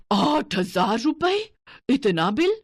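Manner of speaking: slow, high, stunned question; shocked disbelief. Acted. Mother-in-law reading the huge electricity bill, stunned and slow. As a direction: stretch it out in disbelief. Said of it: "घबरा जाती है".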